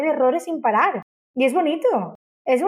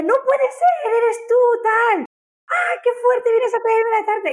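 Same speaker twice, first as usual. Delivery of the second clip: imitating thrilled customers; 10 semitones higher than usual. Customer shrieking at Lara on delivery, high and thrilled. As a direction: squeal like a fan meeting the owner. Customers greeting her at the door.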